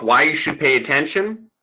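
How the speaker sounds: usual speaking voice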